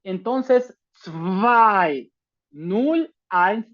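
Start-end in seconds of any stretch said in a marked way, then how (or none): 0.9-2.1 "zwei" as a slow "tsvai"; zwei con TS y V. Shown as a demonstration. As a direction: draw out "zwei" with a clear ts and v. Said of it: "suena como una V". ("zwei" lento, como "tsvai")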